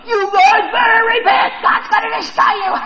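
very high, loud mock shriek; shrieked warning. Preacher imagines Jonah threatening the city, high hammed-up shout. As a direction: screech it out as a hammed-up threat of doom. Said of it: "I wonder if he's like".

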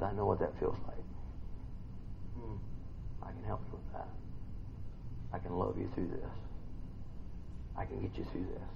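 soft, low, near-whispered reassurance; tender comfort. Acted. God soothing the brokenhearted listener, soft and low. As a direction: comfort them in a hush.

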